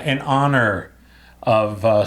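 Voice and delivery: ordinary talk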